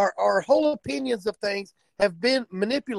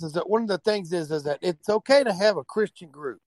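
ordinary talk, nothing marked

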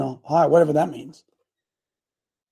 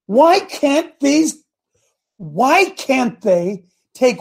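exasperated prayer; 6 semitones higher, slower than usual. Retold or imitated. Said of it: "I said".

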